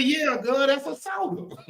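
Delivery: high, put-on drawled reply; retold country reply. Host retelling his soda answer to the producer in a high put-on voice. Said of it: "I said".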